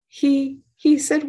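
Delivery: plain reading voice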